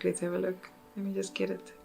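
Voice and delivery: normal talking voice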